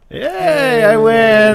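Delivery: high gleeful victory cheer; excited cheer. Real, not acted. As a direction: crow about winning. Wins the guessing game.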